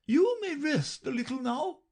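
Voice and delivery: high, kindly queen voice. A narrator's reading. Queen props Alice against a tree, high and kind. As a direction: let her rest, gently. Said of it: "said kindly".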